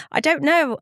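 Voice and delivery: laughing, helpless answer. Real, not acted. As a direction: laugh it off, pitch up.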